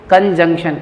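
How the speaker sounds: कंजंक्शन with nasal middle; correct pronunciation. Shown as a demonstration. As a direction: model "kan-jung-shan" for कंजंक्शन. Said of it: "क्या है ये?". (कंजंक्शन में न की आवाज़)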